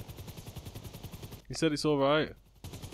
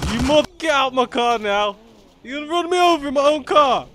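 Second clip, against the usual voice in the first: furious shout, much higher and louder; angry yelling; 10 semitones higher, 10 dB louder than usual. Real, not acted. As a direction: yell at the thief taking your car. Player's car stolen in game.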